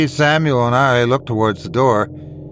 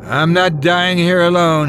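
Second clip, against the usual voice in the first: raised, sustained pitch with menace; maniacal menace; 5 semitones higher than usual. A narrator's reading. Bully refuses to die alone, maniacal. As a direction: hold it high like a madman, no laugh. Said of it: "he laughed maniacally".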